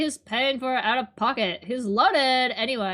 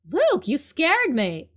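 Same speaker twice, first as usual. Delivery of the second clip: ordinary talk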